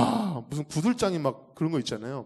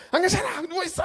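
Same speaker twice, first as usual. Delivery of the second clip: put-on ordering the young man to stay lying down; mock stern shout. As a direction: shout him back down, half laughing.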